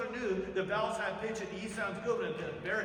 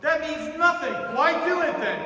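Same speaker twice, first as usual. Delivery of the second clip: louder, higher exasperated teacher; 8 semitones higher, 9 dB louder than usual. Real, not acted. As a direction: push back hard and loud.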